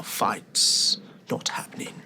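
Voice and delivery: whispered aside. Acted. Speaking to Jacques, a low whisper. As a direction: drop to a hushed whisper.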